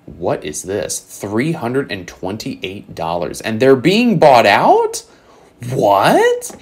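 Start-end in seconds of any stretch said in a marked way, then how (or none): 3.4-6.6 rising, higher and louder in disbelief; incredulous. Real, not acted. Card seller reacting to a shocking booster box price, incredulous. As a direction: stretch it out slowly, then jump to a high-pitched "what?".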